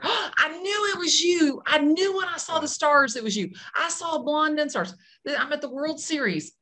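high, gushing fan voice; high-pitched excited fan quote. Retold or imitated. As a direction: play the starstruck fan breathless with excitement. Fan's excited recognition after gasp.

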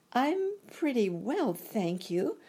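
put-on high, measured little-girl voice; polite girl. A narrator's reading. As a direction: answer politely in a lifted girlish pitch. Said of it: "replied Dorothy politely".

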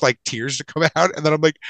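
usual speaking voice

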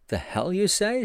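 high, incredulous outburst; a stranger's shocked retort; astonished and angry. A narrator's reading. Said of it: "in tones of astonishment and anger".

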